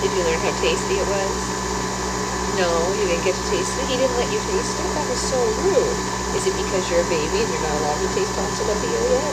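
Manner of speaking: playful questions to an infant. Real, not acted. Questions her baby in baby talk.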